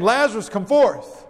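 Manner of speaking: firm order, falling at the end; command. Shown as a demonstration. Preacher demonstrating how Jesus may have ordered Lazarus out, commanding. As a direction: give it as a direct command. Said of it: "Or like a command".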